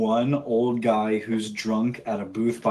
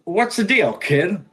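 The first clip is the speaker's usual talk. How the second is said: high, annoyed small-time hustler; impatient Nick; 8 semitones higher than usual. Acted. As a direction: complain to the kid who kept him waiting. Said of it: "He goes".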